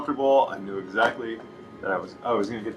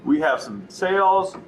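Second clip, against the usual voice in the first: higher, upbeat shop greeting; friendly salesperson greeting; 6 semitones higher than usual. Shown as a demonstration. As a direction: welcome them in and pitch the sale warmly. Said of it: "setting the intention for your interaction".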